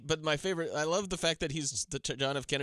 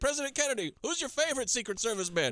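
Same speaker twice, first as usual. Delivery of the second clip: mock interviewer; 9 semitones higher than usual.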